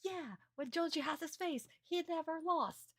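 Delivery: high cheerful put-on voice; cheering Yugi. Fan voicing Yugi cheering for Jonochi, high. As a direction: cheer him on, high and bright. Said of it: "Yugi's, like, cheering as well".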